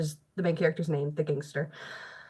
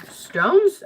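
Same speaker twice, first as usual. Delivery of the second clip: incredulous; 10 semitones higher, 7 dB louder than usual. Real, not acted. Mock disbelief at baggage.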